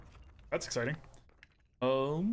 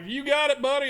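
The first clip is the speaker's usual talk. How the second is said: mock eager reply; 11 semitones higher, 6 dB louder than usual. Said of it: "It's like".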